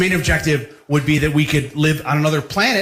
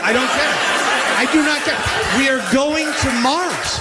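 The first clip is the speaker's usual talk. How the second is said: excited; 9 semitones higher than usual. Real, not acted. Comic rant peak.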